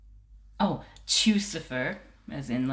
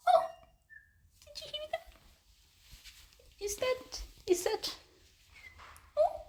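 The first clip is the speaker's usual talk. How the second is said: squeaky-high cooing pet voice. Real, not acted. Cat sitter seeking a lost kitten, high baby talk. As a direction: coax a tiny animal in a very high voice.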